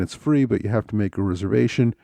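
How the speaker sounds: ordinary talk, nothing marked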